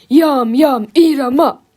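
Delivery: loud monster chant; bellowing. A narrator's reading.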